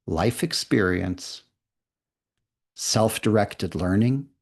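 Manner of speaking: slow read with pauses between phrases; slowly. Shown as a demonstration. Teacher reading the definition a second time, slowly. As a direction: give each phrase room to sink in. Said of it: "Then I'm gonna read it again slowly".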